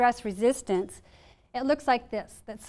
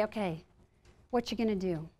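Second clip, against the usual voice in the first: calm self-talk; 4 semitones lower, 8 dB quieter than usual. Shown as a demonstration. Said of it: "bring your energy into your body".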